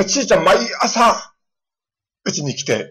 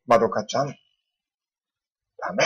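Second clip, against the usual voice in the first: low, soft, very slow stern warning; a pastor hushing a noisy child; quiet scolding; 5 semitones lower, 5 dB quieter, much slower than usual. Retold or imitated. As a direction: scold her gently without raising the voice. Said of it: "大きな声で言いませんでしたけども".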